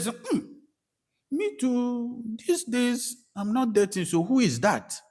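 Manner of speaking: mock surprise. Retelling his startled reply.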